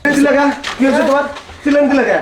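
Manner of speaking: high, loud, urgent questioning; alarmed demand. Acted. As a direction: burst in demanding to know who screamed. Husband rushes in, demands who screamed. (উত্তেজিত হয়ে চড়া গলায় জেরা)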